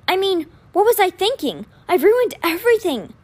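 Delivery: acted agony over a mistake; agonized. A narrator's reading. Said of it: "A look of pure agony".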